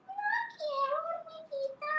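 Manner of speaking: very high refusing cat voice; sing-song no from a cat; cat turning down the ant; stubborn cat. Acted. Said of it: "She said".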